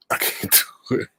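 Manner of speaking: breaking into laughter mid-line; cracking up, can't hold it together; laughing. Real, not acted. Host breaks down laughing on stream.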